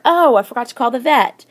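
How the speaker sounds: high, loud sudden realisation; oh no, forgot it; dismayed exclamation. Shown as a demonstration. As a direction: gasp of remembering the vet. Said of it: "exclamation mark".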